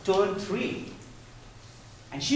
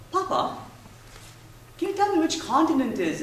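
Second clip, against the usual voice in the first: put-on higher innocent little-girl question; child voice; 9 semitones higher than usual. Daughter asking papa which continent Surrey is in, higher. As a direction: ask like a puzzled small child.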